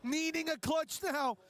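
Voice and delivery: high, excited play-by-play with shouts; esports caster. Acted. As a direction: hype the fight like a live caster. Live play-by-play of a fight.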